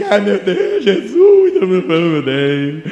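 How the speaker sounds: imitating a scared believer, wailing and dragging; hammed-up fearful moaning to God; mock cowardly whimper. Pastor mocking a cowardly preacher, whining in fake fear. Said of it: "o cara vai ficar frouxo".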